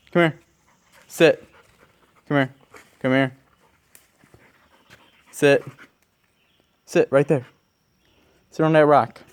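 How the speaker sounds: loud, firm commands to a dog. Real, not acted. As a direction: order the dog to sit.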